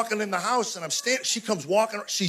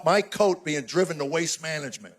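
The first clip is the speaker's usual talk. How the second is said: ordinary talk, nothing marked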